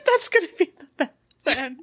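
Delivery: words squeezed through laughter; laughing. Real, not acted. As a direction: laugh while getting it out.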